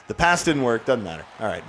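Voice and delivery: normal talking voice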